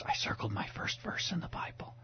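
soft unvoiced whisper. Son whispering to his dad after church. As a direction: whisper it like a child sharing a secret. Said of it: "he whispered in my ears".

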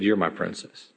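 put-on soft, low, hushed words to a child held close; tender. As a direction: say it gently, like a hug in words. Cinderella hugs the little girl.

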